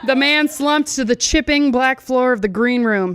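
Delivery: usual speaking voice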